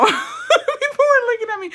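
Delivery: amused, laughing while telling friends. Real, not acted. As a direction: giggle through the retelling.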